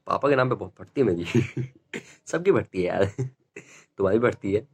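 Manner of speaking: ordinary delivery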